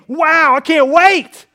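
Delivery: slow, loud, high gushing excitement; mock excited. Preacher imagining Zechariah's thrilled reply, hammed-up excitement. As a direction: gush it like a thrilled old man.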